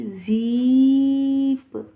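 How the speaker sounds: ज़ीप said slow and loud, long ee; long ee demo. Shown as a demonstration. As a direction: stretch the ee in ज़ीप to teach it. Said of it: "पहले वाले की आवाज़ निकालना है".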